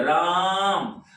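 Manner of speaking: राम with a long, stretched रा; drawn-out stress. Shown as a demonstration. Teacher asking students to say it too, drawn-out stressed राम. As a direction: hold the आ of राम under stress. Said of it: "लंबा स्वर भी खिंचाता".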